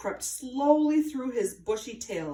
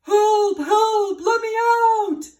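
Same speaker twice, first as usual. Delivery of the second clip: high, loud cry from inside a sack; muffled cry for help; 12 semitones higher, 9 dB louder, slower than usual. A narrator's reading. Squirrel trapped in the sack cries for help, loud. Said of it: "called out in a muffled voice".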